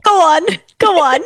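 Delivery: high-pitched mimicry of a British voice; mock British accent. Co-host echoing the AI's "go on", mock British accent. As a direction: parrot it in a put-on British accent.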